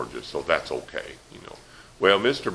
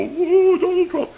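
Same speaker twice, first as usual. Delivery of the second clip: high, loud squealing fan cheer; hammed-up fan squeal; mock rally cheer; 9 dB louder, slower than usual. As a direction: shriek like a rally crowd.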